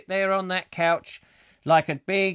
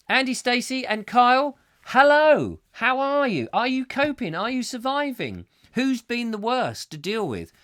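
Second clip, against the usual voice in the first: bright greeting; 7 semitones higher than usual. Real, not acted. Greeting listeners by name.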